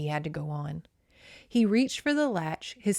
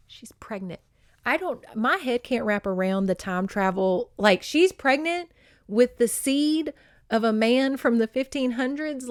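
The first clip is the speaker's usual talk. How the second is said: normal talking voice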